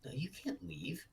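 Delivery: put-on high, eerie childlike doll voice; sing-song creepy little girl; too calm creepy doll. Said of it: "And she goes".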